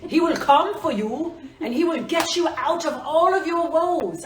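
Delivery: big, high, loud announcer-hero voice; silly hero voice. Pretend. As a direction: hype the crystal up like a heroic champion. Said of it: "This is me being silly".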